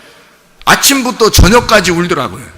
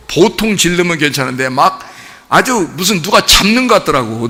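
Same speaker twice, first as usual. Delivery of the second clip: normal talking voice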